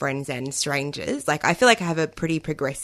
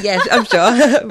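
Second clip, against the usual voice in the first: loud, higher, drawn-out eager yes; 6 semitones higher, 9 dB louder, slower than usual. Real, not acted. Guest answering whether she is a feminist, eager. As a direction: jump in keenly, laughing at yourself. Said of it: "Really eager there to answer".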